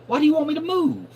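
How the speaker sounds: high, quick mock exasperation; throwing her a pointed question; exasperated retort. Complaining customer at the drive-through window, high and quick exasperated retort. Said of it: "I was like".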